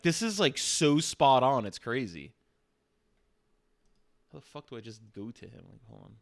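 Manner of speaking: usual speaking voice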